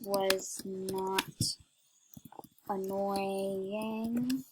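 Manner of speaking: word-by-word dictation. Real, not acted. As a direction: say it word by word, with gaps between.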